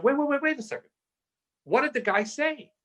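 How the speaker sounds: high-pitched, incredulous cut-in; startled interruption. Retold or imitated. Stopping the seller over the bank's rate, high startled disbelief. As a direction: break in urgently, pitch jumping right up.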